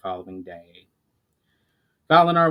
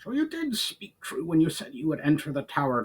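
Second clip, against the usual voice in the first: gruff higher goblin voice; Brawl, growling goblin; 5 semitones higher than usual. A narrator's reading. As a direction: growl it like a sour goblin chief. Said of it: "growled Brawl".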